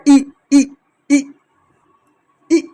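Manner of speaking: ई held high and bright, repeated; vowel ई demo. Shown as a demonstration. Teacher showing children the ई sound. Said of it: "के रूप में इसका ध्वनि आती है".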